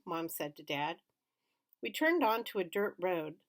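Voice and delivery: ordinary delivery, nothing marked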